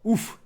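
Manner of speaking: sharp pained grunt; pained oof. Real, not acted. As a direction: yelp out a hurt oof. Taking a hit in the game.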